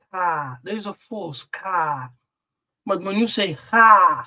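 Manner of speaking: ordinary talk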